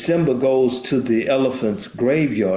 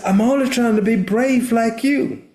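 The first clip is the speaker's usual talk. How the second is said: high, earnest little cub voice; young Simba's voice; 11 semitones higher than usual. Retold or imitated. Cub answers his scolding father, high and earnest. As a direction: pitch it up like a small boy pleading. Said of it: "He says".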